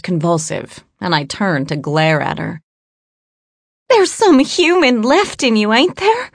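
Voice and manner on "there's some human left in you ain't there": frightened captive voice. A narrator's reading. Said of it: "she asked".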